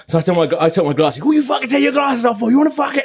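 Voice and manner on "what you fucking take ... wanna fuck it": high, aggressive mock yell; a thug challenges the narrator to fight, high mock yell; mock thug shout. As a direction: square up, spoiling for a fight. Thug sees glasses come off.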